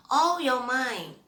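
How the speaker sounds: ordinary delivery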